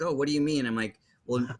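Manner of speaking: ordinary delivery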